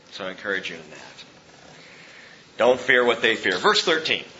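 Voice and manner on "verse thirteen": normal talking voice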